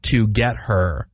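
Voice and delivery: "to get her" as three slow, separate words; spell-it-out "to get her", each word apart; broken down. Shown as a demonstration. Host shows school spelling trick, slow separated "to get her". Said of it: "the trick was".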